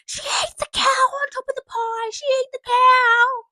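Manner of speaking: very high, loud mock tattletale yell; tattling brother. Brother telling on his sister back home, shrill tattling yell. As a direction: screech it like a sibling telling on you.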